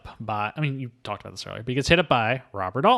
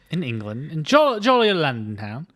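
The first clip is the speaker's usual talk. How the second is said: hammy cockney lilt, higher; British accent; 4 semitones higher, 4 dB louder than usual. Shown as a demonstration. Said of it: "the accent you just heard coming from me".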